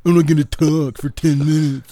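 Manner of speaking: high, loud mocking put-on voice; Phil imitating the internet. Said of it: "my impression of Phil's impression of the internet".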